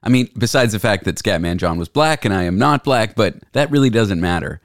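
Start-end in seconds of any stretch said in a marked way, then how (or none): none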